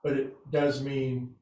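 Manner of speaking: soft, low meditation voice; guided meditation. Acted. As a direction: ease the class into rest, gently and quietly. Leads the class in rest.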